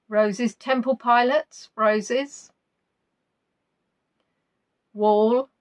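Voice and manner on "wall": ordinary talk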